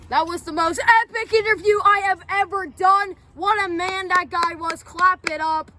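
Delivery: hammed-up ringmaster intro; showman announcer. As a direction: build the crowd up to the big name. Announcing next guest.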